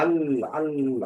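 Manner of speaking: अल, अल, stretched slowly with the ल held; drawn-out demo. Shown as a demonstration. Teacher isolates the particle for students: slow अल, अल,. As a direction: sound out अल, अल, syllable by syllable. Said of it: "अल नहीं पढ़ा जाता".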